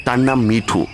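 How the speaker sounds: ordinary delivery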